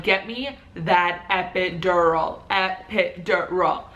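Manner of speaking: slow, deliberate stress on "epidural"; insistent demand. Retold or imitated. Woman in labour to nurse, "epidural" slowly and insistently. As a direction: spell out "epidural" syllable by syllable. Retold demand for pain relief.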